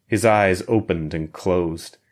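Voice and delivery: ordinary delivery, nothing marked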